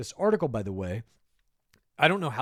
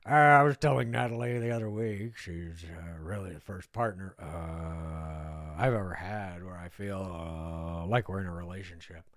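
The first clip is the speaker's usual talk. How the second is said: lower, lazy drawl full of uh's; lazy, lower imitation of a chill bro; Nick Viall's laid-back drawl; 4 semitones lower than usual. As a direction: imitate a too-relaxed guy who can't be bothered. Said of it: "Viall tells me in a laid-back drawl".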